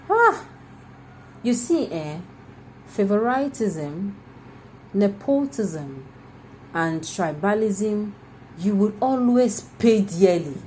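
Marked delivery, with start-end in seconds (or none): 0.1-0.5 sudden high "Ah!" of disbelief; astonished cry; shocked cry. Real, not acted. Outburst at a government claim. 2.9-8.1 each word, "Favoritism", "nepotism", "tribalism", drawn out slowly and weighted; slow deliberate listing of "Favoritism, nepotism, and tribalism"; slow emphatic list. Real, not acted.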